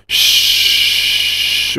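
«шш» whistled through teeth; whistling s. Shown as a demonstration. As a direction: hiss «шш» as a whistle. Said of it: "у вас свистящий звук".

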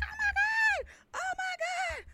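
very high fangirl squeal; mock crowd scream. Crowd as the band comes out, mock scream. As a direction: imitate screaming fans without full volume.

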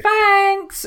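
very high, loud, drawn-out thanks; exaggerated high-pitched imitation of someone breezing past; mock passing thanker. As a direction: sing out thanks in a high caricature. Next person walking through door.

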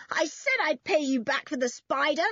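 high, triumphant mild yell. A narrator's reading. Girl paying a boy back for a prank, yelling. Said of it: "yelled George".

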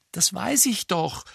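ordinary delivery

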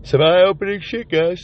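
talking through a yawn. Real, not acted. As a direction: yawn while saying it. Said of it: "Sorry for yawning again".

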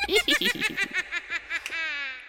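high cackling witch laugh. Acted. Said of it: "maybe a witch laugh".

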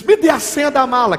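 high, loud demand for the code; robber's demand. Retold or imitated. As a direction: force out the password. (assaltante gritando pela senha)

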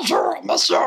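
put-on drunk chipmunk voice. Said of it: "comme un Chipmunks bourré".

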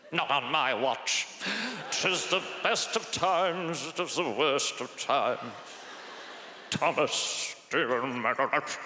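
put-on slow, theatrical old headmaster; slow, mumbling old British gent. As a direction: pronounce it like a pompous Dickensian schoolmaster. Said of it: "old, like, headmasters".

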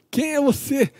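hammed-up indignant yell; mock shout. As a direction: throw it back at her, imitating. Samson's rude retort, shouted.

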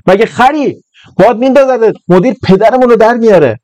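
high, urgent alarmed pleading; alarmed warning. Acted. Asghar grabs his friend's leg, high alarmed warning. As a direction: pull him back with high-pitched panic.

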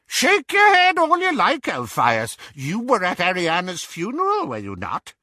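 very high, loud start, dropping at the end; Auntie Muriel, needling. A narrator's reading. Auntie Muriel cornering Elphias, needling. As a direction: goad him as the domineering aunt.

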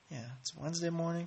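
ordinary delivery

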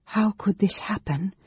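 high-pitched feverish questioning. A narrator's reading. Woman pacing her chamber asks herself, feverishly. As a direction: ask it in a mild fever of agitation. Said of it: "she asked feverishly".

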